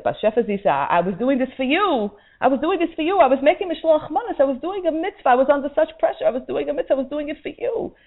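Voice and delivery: high, pleading, trembling defence; frightened pleading. Acted. Woman quaking before the heavenly court, pleading high. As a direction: beg desperately for understanding.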